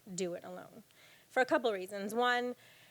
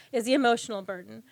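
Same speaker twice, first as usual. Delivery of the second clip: usual speaking voice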